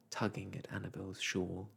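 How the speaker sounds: ordinary delivery, nothing marked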